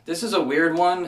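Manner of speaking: normal talking voice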